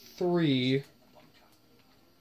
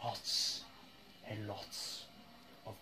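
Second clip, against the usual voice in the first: muttering under breath; 5 semitones higher, 19 dB quieter, slower than usual. Real, not acted. Cursing under breath at game.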